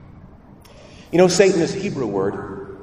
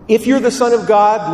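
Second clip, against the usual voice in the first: high, loud devil's taunt; taunting Lucifer; 7 semitones higher, 7 dB louder than usual. Acted. Preacher voicing Lucifer tempting Jesus, loud high taunt. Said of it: "Lucifer says to Jesus".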